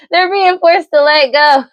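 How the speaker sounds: high, loud call between laughs; gleeful laughing exclamation. Real, not acted. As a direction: crow it out, still laughing.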